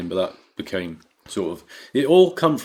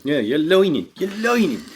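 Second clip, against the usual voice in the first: Brooklyn twang; 7 semitones higher, 3 dB louder than usual. Acted. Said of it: "that's my Brooklyn twang".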